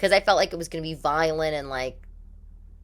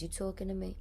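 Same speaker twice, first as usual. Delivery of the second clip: a quiet, lightly voiced De Niro tough-guy line; 4 semitones higher, 11 dB quieter, faster than usual. Host half-imitates De Niro's famous line, quiet and understated. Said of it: "was it De Niro? He says".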